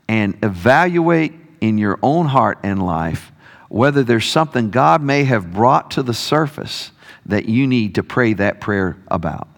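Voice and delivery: ordinary talk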